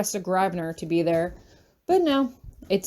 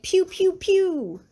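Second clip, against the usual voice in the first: pew pew gun sounds; 10 semitones higher, much slower than usual. Pretend. Finger guns teasing sound effects.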